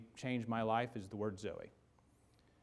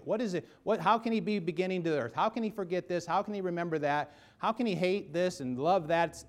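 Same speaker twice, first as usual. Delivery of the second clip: higher, intense barrage of questions; rising rhetorical questions; 5 semitones higher than usual. Real, not acted. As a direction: pile the questions on, building.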